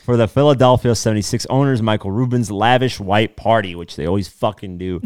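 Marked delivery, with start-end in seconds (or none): 0.0-3.8 reading a gossip article aloud; bright, punchy news-anchor read. Acted.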